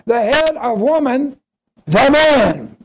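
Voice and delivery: "the man" punched loud after a pause; pause, then hit "the man" hard with a falling close; emphatic reading. Shown as a demonstration. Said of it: "this is the way it should read".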